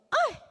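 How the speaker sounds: sharp high yelp of pain; a sudden high pained cry. Retold or imitated. Patient yelps as the scalpel cuts, high pained cry. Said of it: "Eu comecei a chorar de dor".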